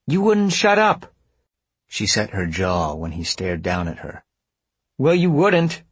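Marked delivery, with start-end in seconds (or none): 0.1-1.1 high, loud retort; irritated, pitch jumping up; exasperated accusation. A narrator's reading. Acted quarrel, blaming him. 5.0-5.8 high, loud insistence; exasperated, pitch raised; defiant retort. A narrator's reading. Insisting on her accusation.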